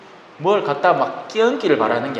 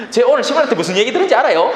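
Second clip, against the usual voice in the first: higher, fast, blurted out; eager blurting; 7 semitones higher, 3 dB louder, faster than usual. Retold or imitated. Believer blurting to the vegetable seller, fast and eager. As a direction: gush it out to a stranger, quick and high. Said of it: "하고 막 까발려".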